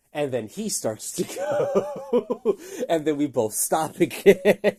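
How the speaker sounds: high, loud, breaking into laughter; laughing at the absurd standoff. Real, not acted. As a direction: laugh through the words, slowly at first. Laughing while retelling the standoff.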